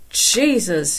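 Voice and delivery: understated maddened outburst; exasperated cry. A narrator's reading. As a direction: cry out at their stupidity. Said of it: "he cried".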